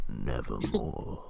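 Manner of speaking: very low, soft and slow nevermore; ominous raven intoning. Pretend. Co-host answers the review in a slow, deep raven voice. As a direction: drag it out deep and dark like the raven.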